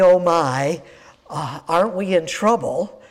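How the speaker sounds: ordinary talk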